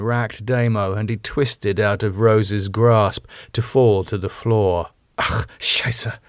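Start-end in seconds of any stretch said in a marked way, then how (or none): 5.2-6.2 whispered German swearing; muttered curse. Acted. Rose cursing as Damo falls, muttered. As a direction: curse under the breath. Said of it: "Rose muttered".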